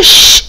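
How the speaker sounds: a long hissed "ش-"; sh sound. Shown as a demonstration. Teacher showing pupils how S and H sound together. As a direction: hold the "ش-" sound. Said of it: "الصوت ده طالع منين".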